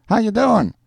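loud, very high street greeting; calling across. A narrator's reading. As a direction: call it out high to reach a neighbour. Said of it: "he called across to Schultz".

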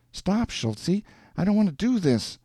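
high-pitched, understated plea to stop; distressed protest. A narrator's reading. Woman resists man's touch, mildly distressed plea. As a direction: protest in a high voice without shouting.

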